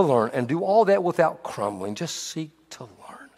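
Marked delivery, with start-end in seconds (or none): 1.9-3.3 soft unvoiced whisper, slowed. Real, not acted. Preacher to congregation, whispering the last tip. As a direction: breathe it as a gentle aside.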